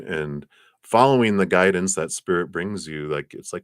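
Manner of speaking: ordinary delivery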